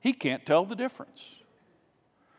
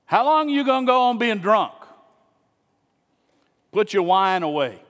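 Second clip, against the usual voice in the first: put-on loud, higher, harsh rebuke of a woman he thinks is drunk; scolding accusation; 5 semitones higher, 5 dB louder than usual. Old priest scolds praying woman, loud and scornful. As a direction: bark it at her like a scornful priest. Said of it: "He looks at her and says".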